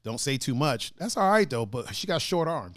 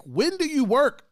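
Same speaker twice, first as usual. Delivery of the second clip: baffled question; 11 semitones higher, 4 dB louder, slower than usual. Retold or imitated. Mock question to trainer.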